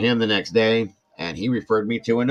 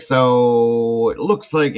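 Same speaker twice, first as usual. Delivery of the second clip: drawn-out, louder doctor's lead-in; slow serious opener; 4 semitones higher, 7 dB louder, much slower than usual. Retold or imitated. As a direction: stretch it out like bad news is coming. Doctor starts showing the scan.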